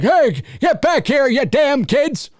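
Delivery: high, slow mock holler; huffing, puffing sheriff. Host plays winded sheriff chasing trespassers, huffing shout. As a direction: shout after kids while puffing along. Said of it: "he's huffing and puffing".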